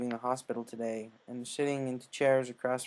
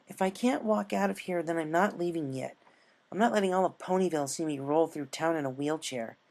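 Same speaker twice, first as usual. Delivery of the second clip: stubborn tomboy voice; 4 semitones higher than usual. Acted.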